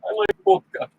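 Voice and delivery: mock Russian accent. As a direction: ham up a cartoon Russian accent. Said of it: "You can make an accent".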